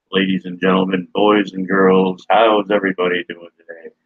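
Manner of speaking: short repeat of the ringmaster intro; grand entrance announcement. Acted. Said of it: "repeated intro".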